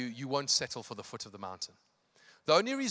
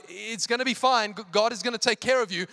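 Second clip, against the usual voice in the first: high, stammering false reassurance; mock-nervous calming of a crowd; hiding panic; 7 semitones higher, 7 dB louder than usual. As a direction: reassure while freaking out inside. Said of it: "who's also freaking out inside".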